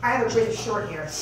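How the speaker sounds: normal talking voice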